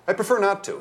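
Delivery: air of superiority. A narrator's reading. Mister Dangletooth retaliating, superior. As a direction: refuse from on high. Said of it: "he retaliated with an air of superiority".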